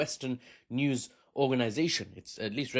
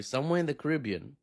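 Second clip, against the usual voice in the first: ordinary talk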